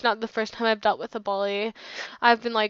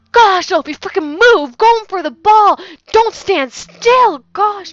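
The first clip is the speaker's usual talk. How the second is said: retold scream, much higher and louder; a friend turned on her, imitated shouting at her; 9 semitones higher, 12 dB louder, slower than usual. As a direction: scream at her like an angry teammate. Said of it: "he's just screaming at me".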